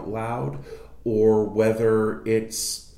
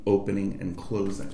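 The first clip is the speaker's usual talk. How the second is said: usual speaking voice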